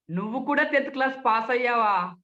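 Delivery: sarcastic. Shown as a demonstration. Speech trainer needles someone who passed tenth class, sarcastic. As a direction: needle them with feigned disbelief. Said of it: "వ్యంగ్యంగా".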